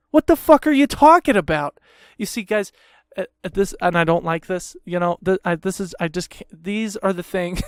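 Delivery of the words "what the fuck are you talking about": high-pitched, indignant demand; incredulous rant at the company; exasperated outburst. Acted. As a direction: raise the pitch in angry disbelief. Ranting at the insurer about fees.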